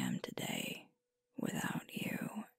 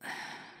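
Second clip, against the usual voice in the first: long breathy exhale; soft sigh; 7 dB quieter than usual. Acted. Alpha pausing after admitting they miss family, soft sigh. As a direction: let out a quiet sigh. Heavy moment of confession.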